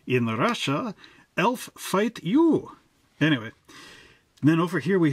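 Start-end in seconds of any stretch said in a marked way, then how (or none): 0.1-2.7 jokey mock Russian accent, the last words slow and much higher. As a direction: ham up a Russian elf in a Russian accent. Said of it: "Russian elves, eh?".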